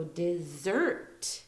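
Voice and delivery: DESSERT slowly, short "di", long ZERT; drawn-out "di-ZERT"; stress on ZERT. Shown as a demonstration. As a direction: land hard on the second syllable of DESSERT. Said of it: "so the zert part".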